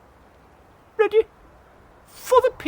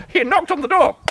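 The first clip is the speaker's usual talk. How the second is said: ordinary delivery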